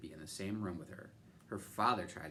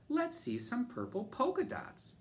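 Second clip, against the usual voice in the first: high teasing yell; 4 dB louder than usual. A narrator's reading. Classmate teasing Camilla, yelling out. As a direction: kid taunting across the class. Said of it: "One yelled out".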